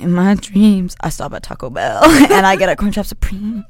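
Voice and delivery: ordinary delivery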